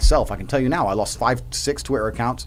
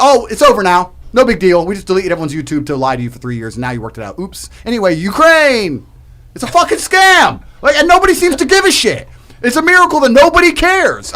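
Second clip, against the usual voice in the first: loud, high sarcastic mockery of officials; mocking the authorities; 6 semitones higher, 7 dB louder than usual. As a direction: sneer it out as the people in charge. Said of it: "and then you're gonna".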